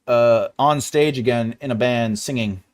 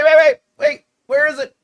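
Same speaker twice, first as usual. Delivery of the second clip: ordinary talk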